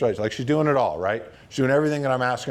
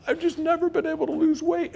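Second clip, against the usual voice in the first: very high, put-on tearful voice; squeaky-high mock weeping; imitated crying. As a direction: imitate a client bawling as she speaks. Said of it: "She starts crying".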